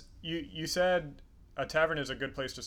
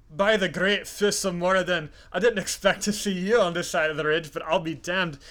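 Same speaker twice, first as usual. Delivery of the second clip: loud, hammed-up Scotch-Irish greeting; Scotch-Irish accent; 3 semitones higher, 6 dB louder than usual. Dwarf surprised to meet an old friend, booming Scotch-Irish accent. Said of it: "my terrible Scotch-Irish accent".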